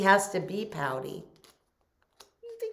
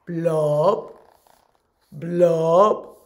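fish going blub, growing louder; gurgling fish man croak; 11 dB louder, much slower than usual. Acted. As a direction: bubble it out, louder each time. Fish bubbling sound.

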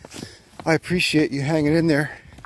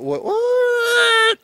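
high squeaky surprised what; sudden high yelp of surprise; surprised squeak; 4 dB louder than usual. Real, not acted. Stream suddenly gone.

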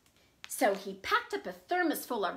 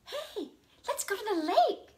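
put-on higher, eager kids' voice; eager kids; 7 semitones higher than usual. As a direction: pitch it up like excited kids. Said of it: "said Junie and Jakey".

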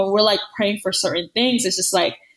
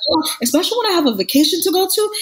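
higher and fast, half-joking exasperation; mock exasperated; 9 semitones higher, faster than usual. Friend joking about a late package, fast and exasperated. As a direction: rush it out like a fed-up shopper. Retold impatience over late order.

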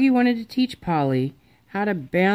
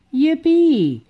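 much higher, louder whoop; overjoyed cheer; 10 semitones higher, 5 dB louder than usual. A narrator's reading. Froggy cheering that he can care for Polly, loud whoop. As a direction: whoop with pure joy.

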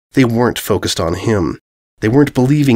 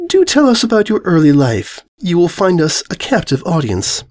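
prim mare; 7 semitones higher than usual. A narrator's reading. Mare voiced.

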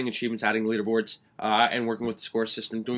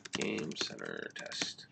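very soft, low and slow; murmuring to oneself; 13 semitones lower, 12 dB quieter, much slower than usual. Real, not acted. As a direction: murmur it slowly while typing. Murmuring to himself while typing.

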